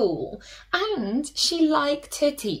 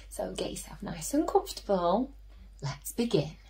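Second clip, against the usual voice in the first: soft, fast whisper to settle listeners; cosy storytime whisper; 3 semitones lower, 8 dB quieter, faster than usual. Real, not acted. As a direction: hush them in nice and comfy.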